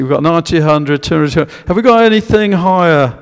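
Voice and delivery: lively auctioneer calling for bids. Pretend. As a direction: drum up the bidding like an auction house. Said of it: "I'm sound like an auctioneer here".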